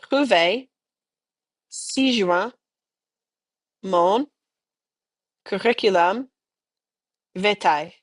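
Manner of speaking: one word at a time, long pauses between; slow dictation. Shown as a demonstration. Teacher dictating a phrase for students to repeat, slowly. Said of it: "We're gonna go slow".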